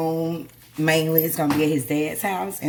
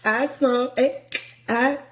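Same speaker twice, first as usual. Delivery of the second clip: high, loud defiant declaration; loud high exclamation; 9 semitones higher, 4 dB louder than usual. Real, not acted. Exclaims confession loudly.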